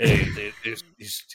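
low, deep sultry voice; sultry narrator. Retold or imitated. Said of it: "he's got some deep, sultry voice".

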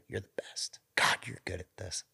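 breathy whispered flattery; playful hushed praise; mock whisper. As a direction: whisper it like a secret compliment. Flattering the audience member.

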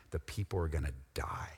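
whispered, the people are gonna die; a dark whispered warning; hushed whisper. Acted. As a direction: drop to a grave whisper.

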